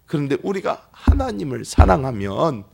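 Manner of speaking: ordinary talk, nothing marked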